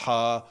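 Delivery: "ہا" pronounced fully from the throat; full articulation. Shown as a demonstration. Student showing how Quran class teaches letters, full articulation. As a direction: sound out "ہا" as in Quran recitation. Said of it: "یہ پورے تلفظ کے ساتھ نکالتے ہیں".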